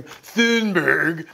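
Thunberg with a long oo sound, thoon-berg; Thunberg pronounced. Shown as a demonstration. To listeners unsure of the name, Thunberg said as thoon-berg. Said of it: "wherever you pronounce her name".

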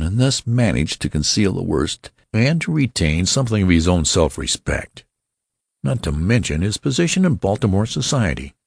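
usual speaking voice

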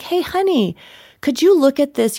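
high, lilting mock husband voice; sweetly coaxing "hey honey"; husband's sweet request. Wife imitating her husband asking for help, high and sweet.

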